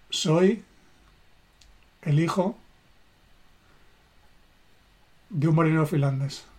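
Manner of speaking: slow, with long pauses between the words; a heartfelt statement with pauses; felt slowly, word by word. Shown as a demonstration. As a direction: let each word land, feeling it. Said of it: "sintiendo cada palabra".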